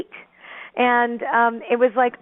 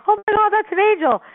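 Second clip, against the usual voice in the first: much higher, louder gasp of awe; stunned recognition, pitched way up; awestruck exclamation; 11 semitones higher, 4 dB louder than usual. Retold or imitated. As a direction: cry it out in sudden wonder. Realising it is an angel.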